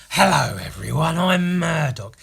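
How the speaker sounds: loud, stretched greeting, impression not quite landed; Murdoch. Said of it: "But Murdoch's more like".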